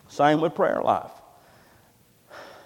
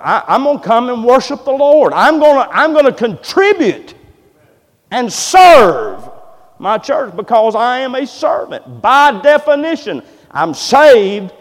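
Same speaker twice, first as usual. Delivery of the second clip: loud, rising preacher's cry; preaching at full force; 7 semitones higher, 9 dB louder than usual. Acted. Pastor rallying his church, preaching loud and hard. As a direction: drive the point home to the congregation. Voicing the committed servant, rising.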